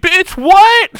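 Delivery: very high, loud mock yell; loud, high-pitched stunned outburst; imitated outraged shock. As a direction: shriek it in comic disbelief. Retold shock at losing arm.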